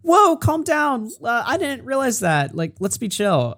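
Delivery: high, drawn-out imitation of her backing off; mock placating. Streamer imitating the woman in chat backing down, mock placating. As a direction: mimic someone pleading for calm, softening as it goes. Voices her telling them to calm down.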